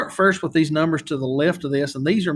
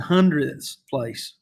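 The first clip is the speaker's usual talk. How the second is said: hundredths said slowly, with the final THS pushed out; slow down and stress the end of hundredths; much slower than usual. Shown as a demonstration. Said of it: "emphasis there at the end".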